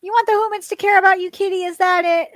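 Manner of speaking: loud, high, quick question to a pet; high pet-talk. Real, not acted. Streamer repeats her question to the cat, high and loud. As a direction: pitch it up and press the cat for an answer.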